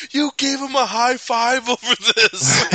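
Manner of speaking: high, loud, drawn-out disbelief; gleeful mock taunt. Host imitating partner teasing the captain, gleeful high taunt. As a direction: crow it slowly, high and loud.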